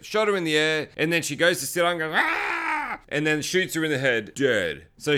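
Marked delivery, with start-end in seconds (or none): 2.2-3.0 high, drawn-out mock zombie screech; imitated zombie cry.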